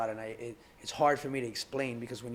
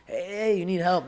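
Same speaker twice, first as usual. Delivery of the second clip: a yacht crew member hailing a drowning man, higher and louder; calling out from the yacht; 6 semitones higher, 5 dB louder than usual. Retold or imitated. Joke about a drowning man, yacht crew calling out higher and louder. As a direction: shout out over the water to the man on the raft.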